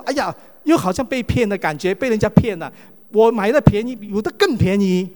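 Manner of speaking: angry complaint. Retold or imitated. Preacher voicing a shopper who finds it cheaper elsewhere, loud indignant complaint. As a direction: complain loudly like a shopper who's been had. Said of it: "你就很生气说".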